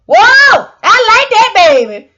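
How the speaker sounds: a delighted fan gushing about the program; impressed exclaim. Retold or imitated. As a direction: cry out like you're bowled over. New people signing up, impressed.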